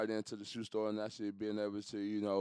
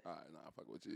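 whispered aside; 6 semitones lower, 18 dB quieter than usual. Real, not acted. Hushed aside to his friend, whispering. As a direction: drop to a whisper.

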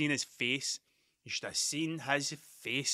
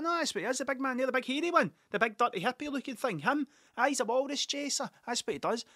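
high, eager mock insistence; insisting uncle; 8 semitones higher than usual. Uncle convincing sceptical pub friends, high and eager. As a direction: press the point with a pitched-up voice.

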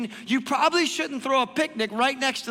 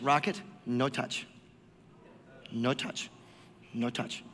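low firm warning; 10 semitones lower, 10 dB quieter, slower than usual. Real, not acted. Warning dog off treat.

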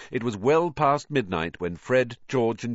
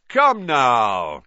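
loud, higher, breezy call to the class; beaming cry; 4 semitones higher, 9 dB louder than usual. A narrator's reading. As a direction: cheerfully cry out over the gloom. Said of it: "he cried, beaming".

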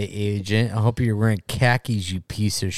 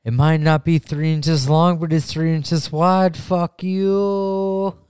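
high mock boast; 8 semitones higher, 5 dB louder than usual.

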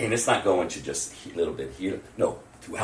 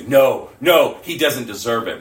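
sudden loud objection, easing off; protesting; 4 semitones higher, 9 dB louder than usual. Acted. Some people objecting to mercy, protesting. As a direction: burst out with a firm refusal.

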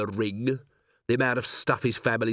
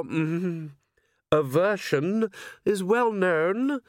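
silky vampire aristocrat; 8 semitones higher than usual. Acted. Cornered vampire toying with the commander, silky and amused. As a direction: purr each word with smug patience. Vampire Dragon King of Arms speaks.

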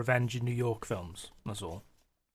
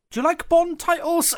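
much higher, playful question; retold eager question; 14 semitones higher, 3 dB louder than usual. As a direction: pitch it right up as you ask. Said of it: "I went into it and I was like".

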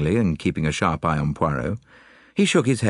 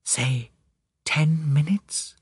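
hushed, halting whisper; 10 semitones higher, much slower than usual. A narrator's reading. Lady naming a deadline to detective, whispered. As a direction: whisper it slowly. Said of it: "she whispered".

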